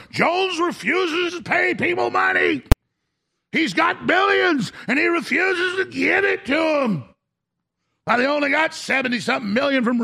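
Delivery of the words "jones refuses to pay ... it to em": mock headline shout. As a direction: bellow it like a sensational headline. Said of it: "You know".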